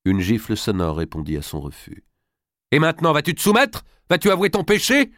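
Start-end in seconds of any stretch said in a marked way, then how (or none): 2.7-5.1 high, raised voice pressing for confession; furious demand. Acted. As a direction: demand an answer from your disobedient daughter. Mother demands confession after slap.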